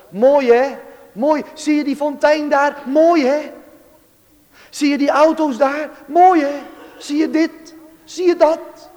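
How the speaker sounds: high, loud eager pointing-out; urging someone to look at everything; excited boy. Retold or imitated. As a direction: imitate a chattering, enthusiastic friend. Neighbour boy eagerly pointing things out.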